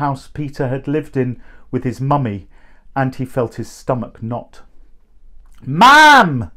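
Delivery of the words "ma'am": loud high call, falling at the end; boy calling his mother from outside the door; shout through the letterbox. A narrator's reading. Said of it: "John shouted through the letterbox".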